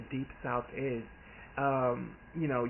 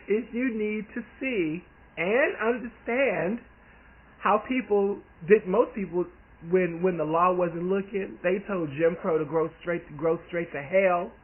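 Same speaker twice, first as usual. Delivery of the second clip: fired up; 5 semitones higher, 5 dB louder than usual. Retold or imitated. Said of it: "I'm like".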